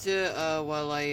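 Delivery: ordinary talk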